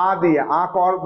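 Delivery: ordinary delivery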